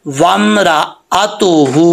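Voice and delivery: drawn-out वमराअतुहु, fresh attack on अतुहु; clear hamza. Shown as a demonstration. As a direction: separate रा from अ, hold the last vowel. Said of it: "हमज़ा को clear करेंगे". (हमज़ा साफ़, ठहरकर वमराअतुहु)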